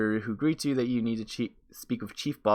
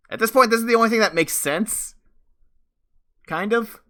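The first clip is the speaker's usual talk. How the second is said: high, loud, strained disbelief; incredulous; 11 semitones higher, 6 dB louder than usual. Real, not acted. Player reacting to the game's odd plot, incredulous. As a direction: pitch it way up in baffled reaction.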